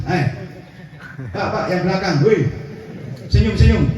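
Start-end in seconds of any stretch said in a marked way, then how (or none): 1.4-3.8 calling out. Real, not acted. Urging the back rows to smile.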